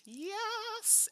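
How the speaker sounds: high, happy little cheer; excited yes. Real, not acted. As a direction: celebrate the lucky draw. Gets the card she wanted.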